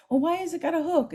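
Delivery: put-on high whiny kid question; imitating a student. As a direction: ask like a puzzled child. Student asking teacher.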